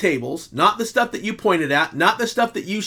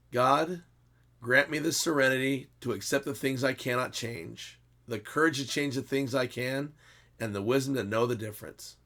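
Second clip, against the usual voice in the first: soft, low reciting of a prayer; 4 semitones lower, 10 dB quieter than usual. Acted. Closing the video for his viewers with the Serenity Prayer, soft prayer. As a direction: recite it softly and earnestly.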